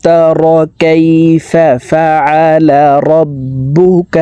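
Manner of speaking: slow tajweed chant pitched above speech; sustained, melodic teaching recitation; Quran recitation. Shown as a demonstration. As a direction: recite with an extremely long final vowel.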